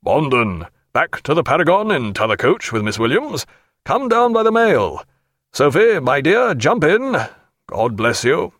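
hearty captain. Acted. Jack orders Bondon and sends Sophia off, hearty. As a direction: give orders, then warmly. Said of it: "Jack ... cried".

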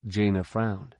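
ordinary delivery

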